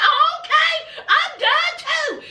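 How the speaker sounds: very high, drawn-out mock huff; petulant retort. Boyfriend answering her breakup, petulant retort. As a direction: imitate someone throwing the words right back.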